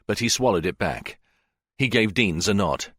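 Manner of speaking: ordinary delivery, nothing marked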